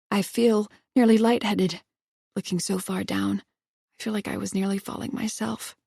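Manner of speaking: ordinary delivery, nothing marked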